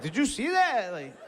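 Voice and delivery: high, excited bragging; cocky taunt. Retold or imitated. Fighter who just dented his car taunts the other guy. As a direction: brag and goad him on with a laugh. Taunting opponent after hit.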